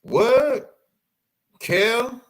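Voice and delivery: can't believe who it is; surprised. Real, not acted. Recognising actor in costume.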